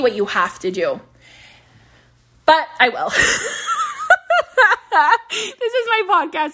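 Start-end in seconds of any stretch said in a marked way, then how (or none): 3.1-5.2 bright high-pitched burst of laughter. Real, not acted. Laughs at own joke. 5.3-6.5 said through laughter; laughing. Real, not acted. Laughing at own joke.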